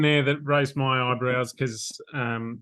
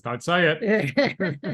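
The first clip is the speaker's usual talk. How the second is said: mock exasperated plea; 5 semitones higher, 4 dB louder, much slower than usual.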